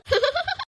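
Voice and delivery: short high-pitched laugh; high giggle. Real, not acted. Friend laughs as girl fears facing brother, high giggle.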